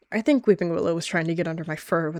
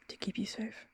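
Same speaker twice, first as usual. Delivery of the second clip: soft unvoiced whisper; a hushed, intense whisper; 5 semitones higher, 10 dB quieter than usual. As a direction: breathe it like a secret promise. Whispered vow to lover.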